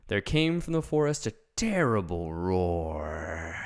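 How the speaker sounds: plain reading voice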